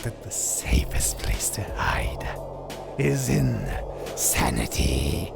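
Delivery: low, half-whispered riddle answer; hushed villain's punchline; sinister aside. Acted. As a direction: drop low and whispery, drawing out the last word. Villain gives his riddle's answer.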